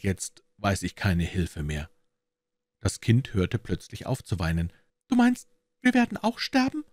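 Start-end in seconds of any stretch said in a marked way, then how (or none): 5.1-6.8 put-on very high little girl's voice; small child asking to be sure; high-pitched little-kid question. A narrator's reading. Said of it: "fragte es".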